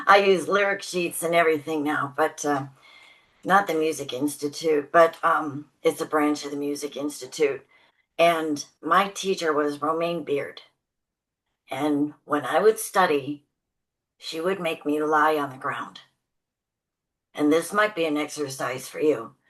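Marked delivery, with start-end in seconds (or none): none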